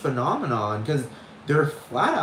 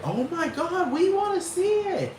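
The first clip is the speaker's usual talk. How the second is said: high-pitched gushing crowd voice; mock excitement. The public reacting to teleportation news, high mock excitement. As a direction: squeal it like an eager onlooker.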